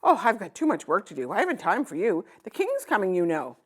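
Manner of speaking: hurried, dismissive craftsman voice; busy craftsman. Acted. Craftsman too busy for the little girl, dismissive. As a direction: brush her off without looking up.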